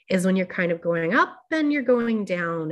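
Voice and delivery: melodic voice rising on up, falling on down; gently lilting, up-and-down melody; sing-song. Shown as a demonstration. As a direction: let the pitch climb, then glide down. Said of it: "we call it a sing-song or a melodic voice".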